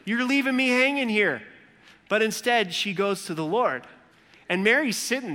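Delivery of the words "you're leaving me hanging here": high, loud exasperated complaint; exasperated Martha. Retold or imitated. Sister Martha fumes to Mary, high and loud. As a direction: vent at a sister who left her working. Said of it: "She's like".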